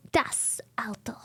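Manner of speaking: whispered German "Das Auto". Pretend. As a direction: hush "Das Auto" like an ad slogan. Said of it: "See German accent?".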